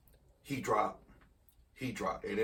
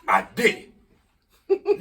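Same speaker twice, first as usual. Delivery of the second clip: loud, much higher hyped caller; wide awake; 8 semitones higher, 13 dB louder, slower than usual. Retold or imitated. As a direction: yell it like you can't sleep. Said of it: "sound like he wide the fuck awoke".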